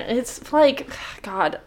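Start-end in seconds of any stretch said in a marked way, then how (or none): none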